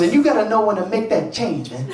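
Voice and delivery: lightly put-on preachy friend. Friend counselling a man about dating, insistent catchphrase delivery.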